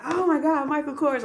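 high, thrilled squeal of delight; gushing over the expected designer gift; excited. Retold or imitated. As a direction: relive the thrill of seeing the name. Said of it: "I was so ready".

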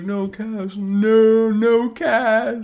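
hammed-up emotional plea, rising; upset pleading. Right-hand man pleading with Kaz, very emotional and upset. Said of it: "is, like, all upset about it".

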